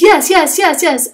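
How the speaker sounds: excited. A narrator's reading. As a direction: jump at the offer like a thrilled kitten. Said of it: "He is excited and says".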